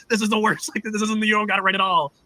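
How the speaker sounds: a hint of a cranky old Irish preacher tearing down the chart; old Darby scolding the church over its chart, a light mock Irish accent; grumpy old Irishman. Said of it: "rip it all down and be like".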